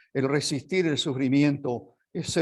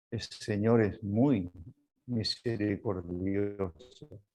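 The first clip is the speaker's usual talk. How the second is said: slow and soft, savouring "misericordioso"; tender, slow emphasis; 3 semitones lower, 4 dB quieter, slower than usual. Shown as a demonstration. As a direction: draw out "muy misericordioso" gently, voice dropping softer. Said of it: "La palabra misericordioso". (lento y suave, saboreando "misericordioso")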